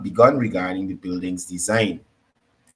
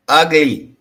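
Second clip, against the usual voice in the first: emphatic loud; 5 semitones higher, 8 dB louder than usual. Real, not acted. Scolding the minister's delivery.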